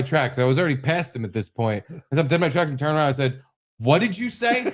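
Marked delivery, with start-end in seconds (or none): 3.8-4.7 much higher, incredulous demand; retold challenge, turning on someone; indignant challenge. Dad turning on muttering neighbor, indignant. Confronts neighbor, stopped dead.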